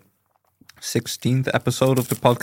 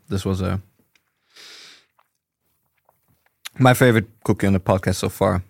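chewing while talking; mouth full; 3 semitones lower than usual. Real, not acted. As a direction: speak through a bite. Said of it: "mouth full of cookies".

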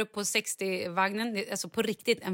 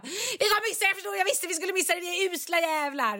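much higher, frantic whining; panisk Charlie; 10 semitones higher than usual. Retold or imitated. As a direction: spill it out in panic. (Charlie klagar i panik, mycket högre)

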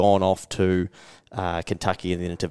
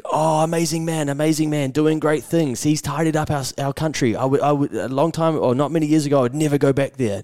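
higher-pitched, gushing praise; enthusiastic taxi driver; 6 semitones higher than usual. Retold or imitated. Salvadoran cab driver praising his president, enthusiastic. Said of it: "a taxi driver from L A".

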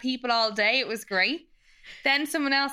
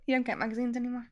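ordinary delivery

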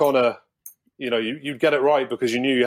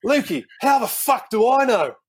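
much higher put-on coach voice, laughing at the line; mock exasperated Matty; 10 semitones higher, 3 dB louder than usual. Player retelling the coach's put-down to teammates, high mocking voice. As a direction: replay the coach's outburst as a high cartoon. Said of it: "that was it".

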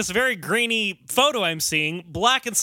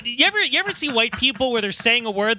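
ordinary talk

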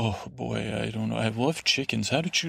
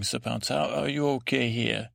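ordinary delivery

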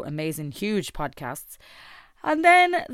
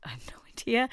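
laughing whisper; 7 semitones higher than usual. Real, not acted. Laughing at forgetting her speech.

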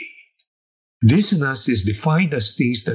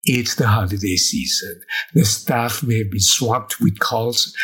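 normal talking voice

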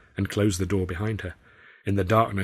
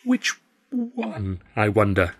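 squeaky fluster; slower than usual. Acted. Arthur flustered.

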